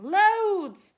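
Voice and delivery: high, very loud call; eager cry. A narrator's reading. Brothers and sisters answer the godmother, high loud cry. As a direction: belt it out like keen youngsters.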